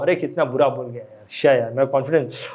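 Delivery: low, soft self-pity; mock crying. Teacher acting someone hurt by criticism, low mopey. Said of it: "एक है रो रहा हूँ".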